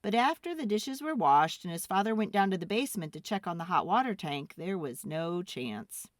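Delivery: usual speaking voice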